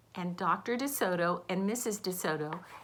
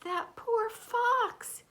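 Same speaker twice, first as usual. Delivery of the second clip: whispered; 14 semitones higher, much slower than usual. A narrator's reading. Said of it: "he whispered to his wife".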